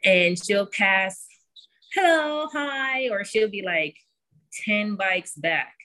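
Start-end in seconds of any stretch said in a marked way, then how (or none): 1.9-3.1 high, sing-song greeting to strangers; cheery calling out. Retold or imitated. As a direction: call out hello brightly, kid-like. Daughter greeting passing cyclists.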